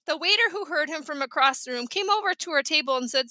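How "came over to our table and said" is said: plain reading voice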